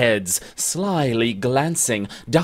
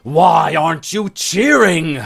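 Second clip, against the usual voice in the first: louder, higher angry demand; angry cry; 5 semitones higher, 7 dB louder than usual. Acted. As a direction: snap at her friend for not cheering. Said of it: "the angry Scot cries".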